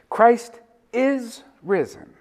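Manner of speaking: high, slow proclamation with "is" held long; stress on IS. Shown as a demonstration. Preacher proclaims resurrection as present reality, stress on IS. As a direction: raise the pitch and stretch "is" as present truth. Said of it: "we should speak like it".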